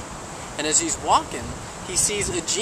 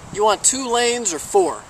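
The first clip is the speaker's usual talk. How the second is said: slow, higher-pitched resigned question; punchline comeback; 6 semitones higher, 4 dB louder, slower than usual. Acted. Genie answering the wish to understand women, slow comeback.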